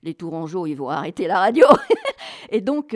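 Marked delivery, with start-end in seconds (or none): 0.7-1.6 loud, breaking into a laugh. Real, not acted. Joking about her own singing. 1.7-2.5 high, loud burst of laughter; loud, high-pitched real laugh. Real, not acted. Laughs at her own joke.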